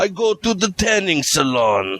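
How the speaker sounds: high-pitched put-on accent; jokey high voice, as the tanned dictator; comic character voice. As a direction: squeal it in a silly accent. Joke mock Saddam voice.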